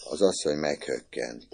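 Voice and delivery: usual speaking voice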